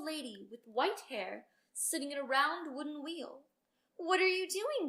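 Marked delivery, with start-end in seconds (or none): 4.0-4.9 curious cry. Acted. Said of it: "cried the princess".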